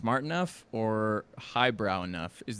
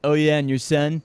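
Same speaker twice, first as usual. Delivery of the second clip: mock Trump taunt; 8 semitones higher, 14 dB louder than usual. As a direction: taunt the opponent in a hammed-up high voice. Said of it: "mock Trump debating Biden".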